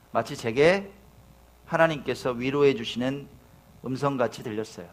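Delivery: normal talking voice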